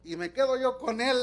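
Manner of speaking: ordinary talk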